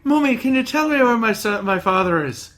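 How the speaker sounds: put-on high childish voice in a rough Irish accent; a kid asking in a put-on Irish accent. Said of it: "not the best Irish accent".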